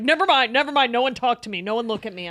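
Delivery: irritated outburst. Retold or imitated. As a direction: snap at everyone to leave you alone. Guy at dinner snapping at everyone.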